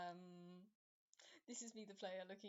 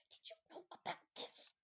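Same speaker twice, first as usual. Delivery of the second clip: loud, forceful whisper; stage whisper; 8 semitones higher, 6 dB louder than usual. Acted. Nanny points at the lock, harsh loud whisper. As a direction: push the whisper out hard and higher. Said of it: "she's gonna whisper".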